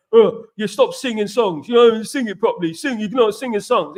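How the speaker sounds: mock the sour bloke who hates singing; miserable neighbour. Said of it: "He was miserable".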